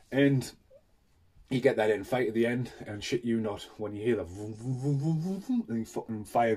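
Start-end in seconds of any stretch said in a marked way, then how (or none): 4.2-5.6 mouth-made rumbling vroom noises; playful vroom sound effects; imitated vroom. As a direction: copy the monster's charging roar. Imitating monster's breath sound.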